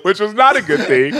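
says it through laughter, high and loud; laughing. Real, not acted. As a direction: laugh while admitting it. Laughing about dropping his daughter.

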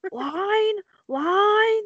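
high-pitched mock stage call; actor calling line. Actor who forgot the script, mock calling for help.

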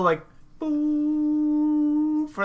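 long high held drone; droning hum. Retold or imitated. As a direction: hold one long note. Imitating a mellow drone.

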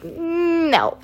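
drawn-out high hum dropping into no; mock-reluctant "mmm", then a falling no; retold refusal. Answering mom about the podcast, drawn-out retold refusal. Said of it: "I was just like".

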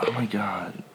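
breathy whispered oh my God; whispering. Real, not acted. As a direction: whisper it in awe. Reacting to food list.

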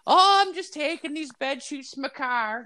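put-on high sweet old woman voice; old lady voice. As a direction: play a sweet innocent granny. Voicing old woman in story.

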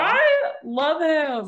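high, loud, drawn-out adoration; gushing. Real, not acted. Co-host fangirling over Hermes, gushing loudly. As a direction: gush slowly over a favourite.

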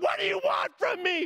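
high-pitched exasperated shout; yelling. Retold or imitated. Flyer to instructors over unclear hand signals, yelling. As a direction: yell it in frustration at the instructors. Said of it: "I'm yelling at them".

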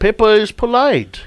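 mock British accent. Mimicking British cartoon accent.

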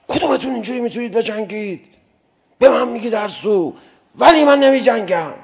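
high, rising to a loud proud cry; proud boast. Acted. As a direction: boast and then refuse defiantly. Zubair boasts then quits.

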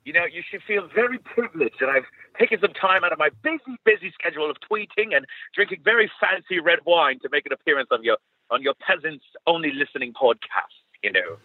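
put-on lofty posh British accent. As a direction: look down on the peasants in posh British. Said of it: "my really posh British accent".

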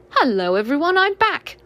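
lightly voiced happy boy; cheerful Christopher Robin. A narrator's reading. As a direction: greet them all, glad to be back.

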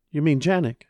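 a mother startled by a name; surprised. Acted. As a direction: caught off guard by the name.

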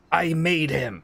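panicked insistence. Acted. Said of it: "he looks really panicked at this".

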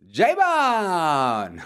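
high, long-held call of the name; drawn-out shout. Acted. Host hollering friend's nickname as the answer, drawn-out shout. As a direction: holler it and stretch out the last vowel. Calls out a nickname mid-story.

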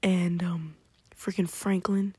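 ordinary talk, nothing marked

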